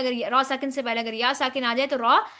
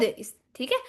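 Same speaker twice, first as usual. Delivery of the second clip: ordinary delivery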